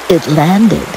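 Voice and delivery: ordinary delivery, nothing marked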